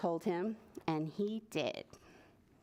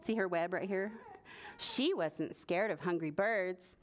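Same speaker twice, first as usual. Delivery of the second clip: plain reading voice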